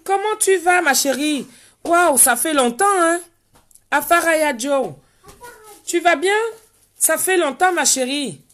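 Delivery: overjoyed to see her friend again; excited greeting. Real, not acted. Greets a long-lost friend live.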